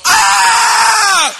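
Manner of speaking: high loud death yell from the game; death scream. Retold or imitated. Soldier shot by sniper, high yell. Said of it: "I hear myself go".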